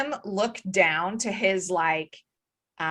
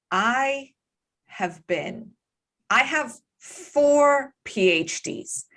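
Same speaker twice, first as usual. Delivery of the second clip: slow, emphatic, affronted academic; indignant scholar; slower than usual. Acted. Scholar confronting the group over their duplicitous stunt, slow indignation. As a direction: draw yourself up and spell out your grievance, slowly. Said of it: "something clicks for him and he's".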